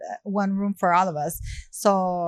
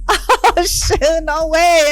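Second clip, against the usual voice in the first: high, loud starstruck disbelief; laughing, high-pitched amazement; amazed shock; 8 semitones higher, 7 dB louder, much slower than usual. Retold or imitated. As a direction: re-enact a loud, thrilled gasp of recognition. Retelling her shock at being recognized.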